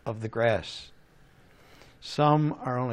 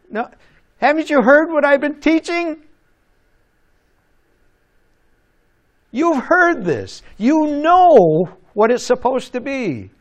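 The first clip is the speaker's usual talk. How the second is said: higher and louder mock scolding; a fed-up teacher telling off the class; 11 semitones higher, 7 dB louder than usual. Teacher scolding the kid, hammed-up higher and louder. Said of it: "like the teacher in school".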